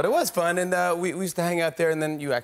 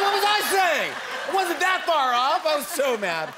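laughing; 14 semitones higher, 3 dB louder than usual. Real, not acted. Laughing recalling embarrassment.

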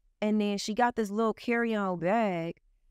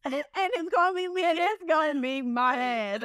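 laughing; 9 semitones higher than usual. Retold or imitated. Imagining herself as the victim.

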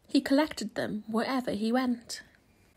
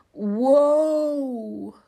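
groan; 2 semitones higher, 7 dB louder than usual. A narrator's reading. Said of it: "groaned Tortoise".

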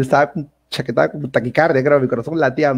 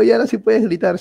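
high, eager retold voice; re-enacted elation; excited announcement; 8 semitones higher than usual. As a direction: share the confirmed good news, acted out. Tells mother it is official.

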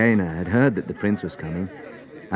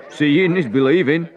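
louder, sneering snicker; snigger; 8 semitones higher, 7 dB louder than usual. Acted. Onlooker sniggering at Jesus, sneering. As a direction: snigger at the idea. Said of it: "someone sniggered".

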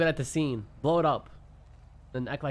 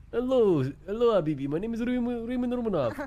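Raymond Romanov voice; 9 semitones higher than usual. Pretend. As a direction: ham up a goofy foreign gangster. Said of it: "Ratchet pulls out".

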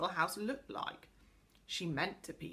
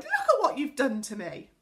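raised, loud, higher wail of protest; outraged cry; 6 semitones higher, 8 dB louder than usual. A narrator's reading. Inky girl accuses the angry pixie, crying out. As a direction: protest loudly at the damage. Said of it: "she cried".

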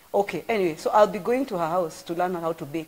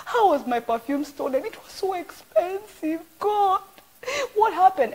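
high, half-crying complaint to God; mock lament; 13 semitones higher than usual. Retelling her complaint to God, high and crying. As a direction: wail the question up high.

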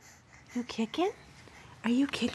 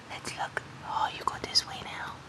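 soft unvoiced whisper; 15 dB quieter than usual. Real, not acted. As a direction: breathe the words out softly. Whispering to baby.

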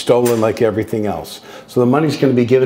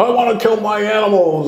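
much higher mock voice of a stubborn meat eater; mocking people; 11 semitones higher than usual. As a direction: parody them in a silly high voice.

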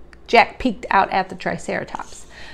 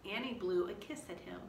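plain reading voice

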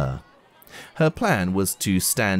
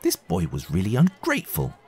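plain reading voice

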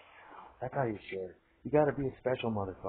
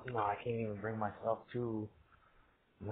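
ordinary talk, nothing marked

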